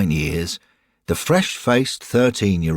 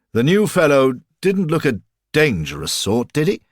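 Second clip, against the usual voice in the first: put-on old man voice; 8 semitones higher, 4 dB louder than usual. Old man asks Derek.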